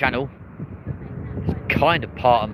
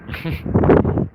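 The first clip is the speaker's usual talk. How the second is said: short laugh; 4 dB louder than usual. Real, not acted. Laughs at viewer comment.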